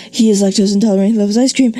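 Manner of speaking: run-on read too fast to breathe; breathless run-on. Shown as a demonstration. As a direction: cram both clauses in one fast breath. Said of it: "Where do you breathe?".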